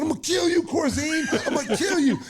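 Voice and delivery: high, loud laughing shout; retold yell. Rookie held back, shouting a threat at the coach, high laughing yell. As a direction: yell the threat across the court. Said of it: "And I said".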